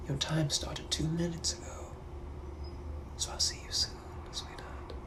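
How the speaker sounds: soft, unvoiced threatening whisper; a hunter's teasing whisper; menacing whisper. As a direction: whisper the threat into their ear. Hunter taunting his prey.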